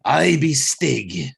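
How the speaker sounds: slow, hammed-up bad Australian accent; horrible Australian accent. Host mimics Stig's way of talking, horrible Australian accent. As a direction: put on a terrible Australian accent. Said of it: "horrible Australian accent".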